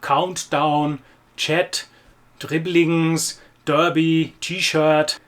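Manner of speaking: English loanwords in an exaggerated German accent. Shown as a demonstration. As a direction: Germanize each borrowed word as a separate item. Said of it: "This really exaggerated German accent".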